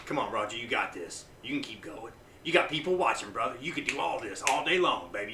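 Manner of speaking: low, soft pep talk starting to build; hyping himself up. Shown as a demonstration. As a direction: psych yourself up, starting quietly. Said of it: "I will hype myself up".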